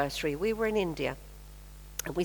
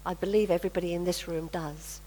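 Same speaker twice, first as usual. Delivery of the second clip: ordinary delivery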